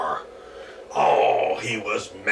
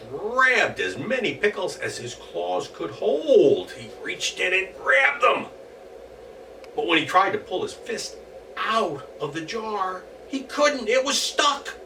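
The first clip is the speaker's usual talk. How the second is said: put-on story narration for children; 10 semitones higher than usual. A narrator's reading. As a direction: read it like a bedtime tale.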